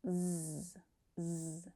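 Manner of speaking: "Z" as a held buzzing zzz, no vowel; voiced Z sound. Shown as a demonstration. Teacher isolating the final sound for learners, a voiced buzzing Z. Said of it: "Voiced Z sound".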